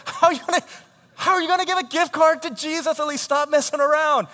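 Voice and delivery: very high, loud mock scolding; hammed-up exasperated parent; exasperated outrage. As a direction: explode in disbelief at a silly child. Acting parent's outraged reply.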